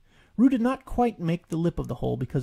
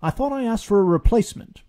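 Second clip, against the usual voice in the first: high, loud irritated Rabbit; annoyed; 8 semitones higher, 8 dB louder than usual. A narrator's reading. Rabbit scolds Alexander Beetle, annoyed and loud. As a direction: snap at the beetle. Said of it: "Rabbit said, annoyed".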